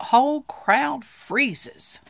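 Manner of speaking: high, loud and slow; dramatic slow build. A narrator's reading. Storyteller freezing the room, slow and loud. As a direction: stretch it out for suspense.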